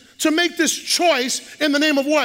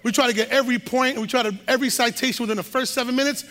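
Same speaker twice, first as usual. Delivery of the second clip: fast talk demo; faster than usual. Shown as a demonstration. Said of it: "we talk fast".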